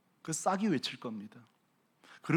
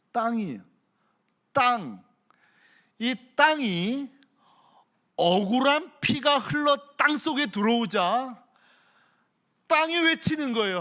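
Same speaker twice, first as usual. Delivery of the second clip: high, loud, slow cry; preaching shout; 7 semitones higher, 7 dB louder than usual. Real, not acted. Preacher shouting the land's outcry to the church. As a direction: thunder it from the pulpit. Preacher crying out about blood.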